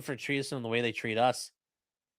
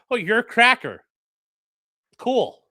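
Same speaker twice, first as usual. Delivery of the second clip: mock unbothered; 7 semitones higher, 6 dB louder than usual.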